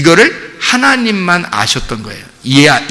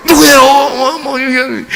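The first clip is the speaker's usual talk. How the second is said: startled question; 7 semitones higher, much slower than usual. Retold or imitated. A startled Paul blurts the question to God's voice. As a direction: jump up in alarm on the question.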